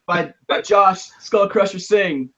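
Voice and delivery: high, loud hype man barker; hype man announcer. Pretend. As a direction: step right up carnival hype. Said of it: "You'd be like".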